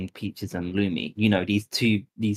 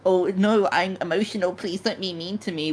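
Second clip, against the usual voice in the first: mocking whine; 9 semitones higher than usual. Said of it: "They fall apart when they fuck up".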